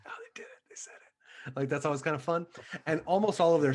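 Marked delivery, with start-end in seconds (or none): 0.0-1.5 excited crowd reaction in a hushed whisper; audience whisper. Retold or imitated. Audience member to neighbour as the title is sung, whispered. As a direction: whisper it like someone nudging a seatmate. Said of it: "everyone in the audience kinda goes like".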